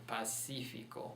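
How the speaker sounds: Pacífico stressed on the second syllable, pa-SI-fi-co; stress as written. Shown as a demonstration. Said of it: "Put the stress mark where it's written".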